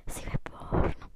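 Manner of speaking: whispered secret aside. Real, not acted.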